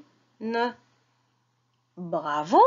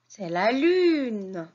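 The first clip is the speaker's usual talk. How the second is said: slow modelling; much slower than usual. Shown as a demonstration. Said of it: "teacher models word".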